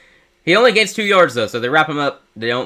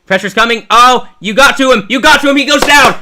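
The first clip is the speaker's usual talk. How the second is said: rising excited sports call, louder and higher; live play-by-play; 7 semitones higher, 7 dB louder than usual. Real, not acted. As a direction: call the sack like a broadcaster. Calls third-down sack live.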